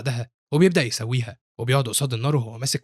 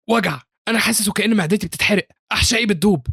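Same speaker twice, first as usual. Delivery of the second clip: high, louder cry of pain; agonised; 8 semitones higher, 4 dB louder than usual. A narrator's reading. Student clutching his stomach after eating, in agony. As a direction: suffer as if burning from inside.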